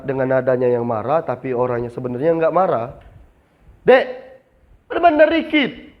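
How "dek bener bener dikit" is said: much higher put-on customer voice; pitched-up mock scolding; harsh-sounding customer. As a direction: play a customer whose tone sounds angry.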